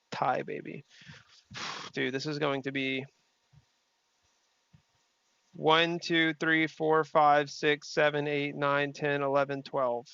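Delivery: usual speaking voice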